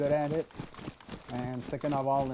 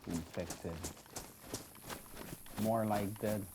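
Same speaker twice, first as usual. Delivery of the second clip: a lone survivor thinking aloud, quiet and lower; in-character survivor; 3 semitones lower, 8 dB quieter than usual. Acted. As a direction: muse to yourself while trekking alone, subdued.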